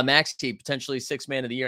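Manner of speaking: ordinary talk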